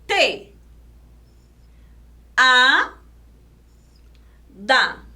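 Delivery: "Pra, Te, A, Da" as four separate beats; trilled PRA, then long pauses between syllables. Shown as a demonstration. Teacher splitting prateada for pupils, syllable by syllable. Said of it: "Vamos separar em sílabas".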